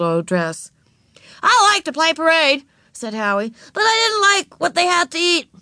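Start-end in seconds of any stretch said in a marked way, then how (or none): 1.4-2.7 put-on high, loud childish voice; high-pitched eager kid; little boy. A narrator's reading. Little boy Howie speaks up. 3.7-5.5 put-on high-pitched childish complaint; blunt small boy, high voice; complaining little boy. A narrator's reading. Boy complains about the food.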